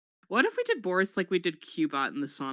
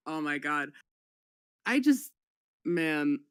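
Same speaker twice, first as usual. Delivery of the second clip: usual speaking voice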